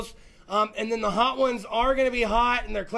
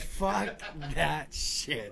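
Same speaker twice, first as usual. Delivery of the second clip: low, slow deadpan repeat; quoting cohost; 8 semitones lower, 7 dB quieter, much slower than usual. Retold or imitated. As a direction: drag it out low and flat. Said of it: "He said".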